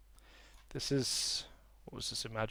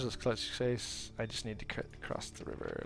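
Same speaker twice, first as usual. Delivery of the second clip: normal talking voice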